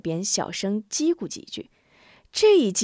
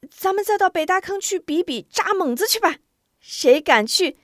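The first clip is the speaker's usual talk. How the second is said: put-on high, loud boy rallying the gang; shouting to the kids; 10 semitones higher, 7 dB louder than usual. Xisheng rallies the children, loud high shout. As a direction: call the whole gang to follow. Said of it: "西胜这一呼喊".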